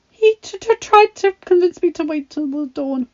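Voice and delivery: high stammering voice through chattering teeth; quiet, chattering teeth. A narrator's reading. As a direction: stutter it out, cold and frightened. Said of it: "Belle said quietly".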